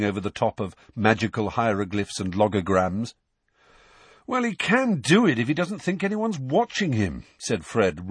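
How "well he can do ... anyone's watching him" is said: high, exaggerated scorn for a teammate; mocking brotherly jibe, swooping high; exasperated mockery. A narrator's reading. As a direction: roll your eyes through the voice, pitched up. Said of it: "rolling his eyes".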